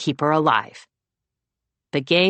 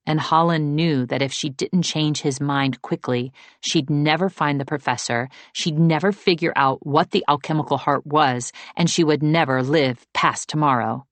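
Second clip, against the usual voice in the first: plain reading voice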